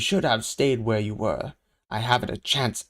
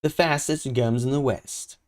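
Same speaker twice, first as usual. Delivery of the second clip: usual speaking voice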